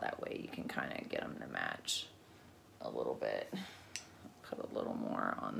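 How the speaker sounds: soft unvoiced whisper. Real, not acted. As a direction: murmur it under your breath. Drops to whisper mid makeup.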